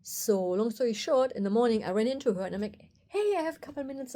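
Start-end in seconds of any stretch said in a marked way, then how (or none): 3.1-4.1 higher, quicker re-enacted hello; bright greeting. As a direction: call out a quick, high-pitched hey. Cheerily approaching the artist.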